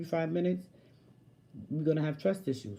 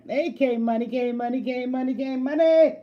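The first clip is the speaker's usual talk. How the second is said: rising, louder call of a name; hailing a friend, getting louder; 8 semitones higher, 7 dB louder than usual. Real, not acted. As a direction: call it out, building to a shout. Calling out.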